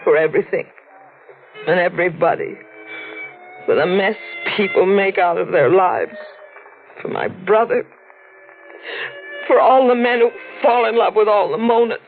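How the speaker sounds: halting lament through held-back tears; subdued sorrow with long pauses; tearful. Acted. Woman tells reporter whom she cries for, tearful. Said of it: "I've always cried".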